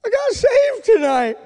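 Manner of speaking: high, loud mock-weeping son; imitated tearful cry. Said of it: "And he starts crying. He says".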